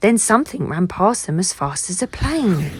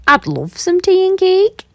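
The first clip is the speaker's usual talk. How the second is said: high, louder smiling reply; 12 semitones higher, 7 dB louder than usual. A narrator's reading. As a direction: answer happily with a smile. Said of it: "said Bruce, smiling".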